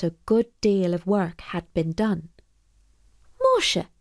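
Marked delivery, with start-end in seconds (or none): 3.4-3.9 high startled cry; exclaiming. Acted. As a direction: blurt it out in surprise. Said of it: "exclaimed Molly".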